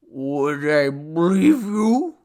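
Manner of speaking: very slow, slurred question; drunken mumble. A narrator's reading. Drunk god doubting the man, mumbling. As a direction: slur the words together thickly. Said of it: "mumbled Ashtaru".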